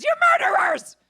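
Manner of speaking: high, loud accusing yell; mock furious yell. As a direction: scream it at the other side. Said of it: "are all yelling".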